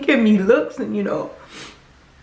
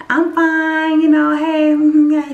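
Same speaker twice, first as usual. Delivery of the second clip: fake polite; 5 semitones higher, 5 dB louder, slower than usual. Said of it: "That's the attitude I was going with".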